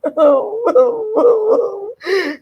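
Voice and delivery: long high-pitched cackle; high laugh. Real, not acted. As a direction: break into a squealing laugh. Laughing at her own bit.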